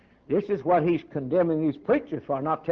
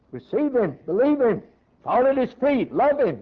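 raised-pitch preacher's commands; urgent exhortation; 6 semitones higher than usual. Real, not acted. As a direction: press each short command on the congregation. Urging listeners in short commands.

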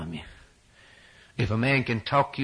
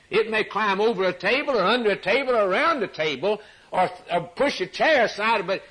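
raised, high-pitched pulpit urgency; excited preacher working through an example; fervent preaching; 9 semitones higher, 3 dB louder than usual. Real, not acted. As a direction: press the illustration home, climbing at the end.

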